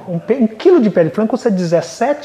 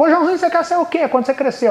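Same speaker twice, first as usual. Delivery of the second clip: a lightly voiced teacher, pitched up; teacher calling on a pupil in class, pitched up; higher teacher voice; 8 semitones higher than usual. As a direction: call on the child like a cheerful teacher.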